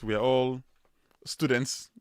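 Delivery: ordinary talk, nothing marked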